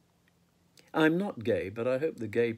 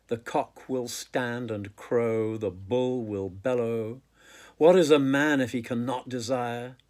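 poem recitation; 4 dB louder than usual. Acted. Poet reading Farewell to Arms to listeners, emphatic recitation. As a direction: declaim the verse, punching the openings of phrases. Reads own poem aloud.

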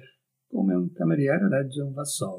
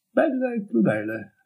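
a high, drifting dreamy tone on Bella; 10 semitones higher than usual. A narrator's reading. The girl repeating his words in a dreamy daze. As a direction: let "Bella e crudele" float up high and fade. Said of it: "ripeté lei in tono sognante".

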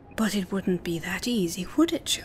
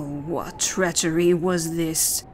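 ordinary delivery

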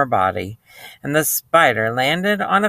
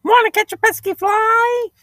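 very high, loud falsetto rooster; squawky, high-pitched talking rooster; 5 dB louder than usual. Acted. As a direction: crow the question out like a rooster. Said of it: "said the rooster".

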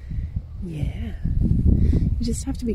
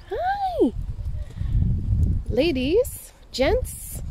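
high-pitched greeting; 11 semitones higher, much slower than usual. Real, not acted.

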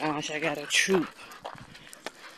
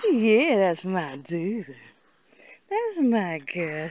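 ordinary delivery

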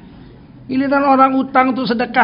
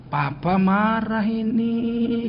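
very slow, soft words through held-back anger; restrained anger; 5 dB quieter, much slower than usual. Retold or imitated. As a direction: speak slowly and softly, keeping the anger locked in. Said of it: "gitu, gemetar tangannya". (ayah menegur anaknya, pelan dan lirih menahan marah)

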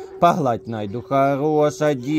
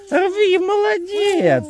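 high praise; 3 dB louder, much slower than usual. Real, not acted. Praising son picking grass.

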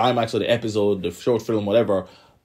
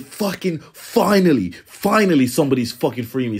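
angry outburst; 4 semitones higher, 3 dB louder than usual. Retold or imitated. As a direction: spit out the finally like she's been caged forever. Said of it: "Ymir was angry".

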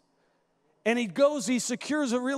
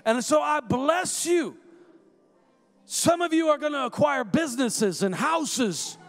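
rising prophetic preaching, fuller voice; 3 semitones higher than usual. Acted. Preacher prophesying rain and harvest over the congregation, preaching. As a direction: declare blessing over the room like a prophet.

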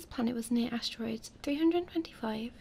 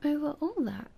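put-on higher, wondering child prince; little prince; 3 semitones higher than usual. A narrator's reading. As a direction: echo it in a raised, amazed voice. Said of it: "said the little prince".

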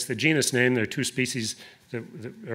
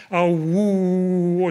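mock howl; 6 semitones higher, 6 dB louder than usual. Dog mocking wolf's howl.

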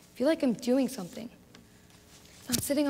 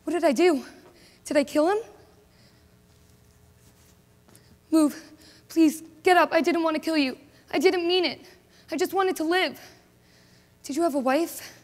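horrified remorse; 4 semitones higher, 4 dB louder than usual. Acted. She has just killed a man.

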